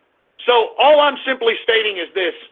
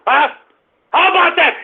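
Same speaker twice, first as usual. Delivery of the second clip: shouted challenge; 7 semitones higher than usual. Real, not acted. Ranting host shouting a challenge at the women marchers. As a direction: throw How about that back at them as a yelled challenge. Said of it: "I'm just pissed off".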